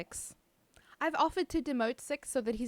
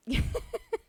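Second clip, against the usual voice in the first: high laugh. Real, not acted. Laughing at command spam.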